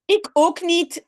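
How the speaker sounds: lightly voiced little boy, me neither; Jip answering. Teacher reading the story, lightly voicing Jip. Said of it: "zegt Jip".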